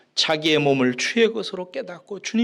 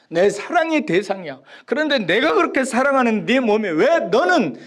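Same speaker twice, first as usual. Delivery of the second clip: pleading lover; 6 semitones higher, 4 dB louder than usual. Retold or imitated. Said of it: "막 얘기해요".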